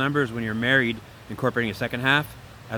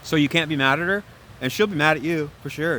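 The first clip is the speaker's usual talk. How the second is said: usual speaking voice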